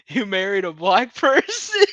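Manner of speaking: can barely finish the line for laughing; laughing through quote. Real, not acted. Quoting Joel to Tommy, laughing. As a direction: voice cracking up with laughter.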